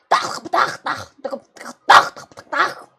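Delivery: loud, harsh guttural fake German; mock German. Insulting every German person in jest, mock German. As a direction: bark out nonsense syllables as exaggerated German. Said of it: "This type of kind of thing".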